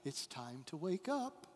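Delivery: soft, tender coaxing like a mother at the cot; gentle mom waking child. Retold or imitated. Mom gently waking her little one in the nursery, soft and tender. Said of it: "mom going into the nursery, touching her little child".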